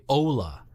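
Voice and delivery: hola with a diphthong, oh-oo-la; hola with an anglicized o; English-accented hola. Shown as a demonstration. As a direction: say hola as English speakers do, gliding o into oo. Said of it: "Most English speakers that I know say".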